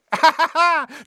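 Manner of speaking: loud mocking poltergeist laugh; high gleeful cackle. A narrator's reading. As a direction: cackle in triumph.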